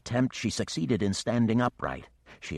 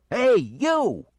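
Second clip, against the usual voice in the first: much higher, louder call upward; holler; 9 dB louder than usual. Acted. Mischief hollers up at the great worm. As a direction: holler up to get the monster's attention. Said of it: "he hollered up".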